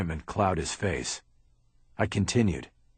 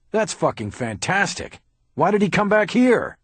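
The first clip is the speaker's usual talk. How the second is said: bitter sarcasm rising into a loud, much higher yell; sarcastic outburst; 11 semitones higher, 6 dB louder than usual. Acted. Haller hearing the killer returned, shouting much higher. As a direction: explode at the detective.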